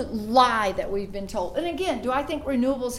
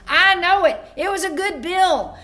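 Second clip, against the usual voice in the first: emphatic agreement; 7 semitones higher, 8 dB louder than usual. Retold or imitated. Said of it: "And he goes".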